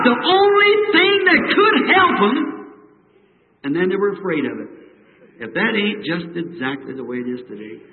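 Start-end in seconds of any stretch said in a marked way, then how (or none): 0.0-2.4 high, loud frightened cry; terrified scream. A narrator's reading. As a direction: shriek it out in terror. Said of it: "they begin to scream out".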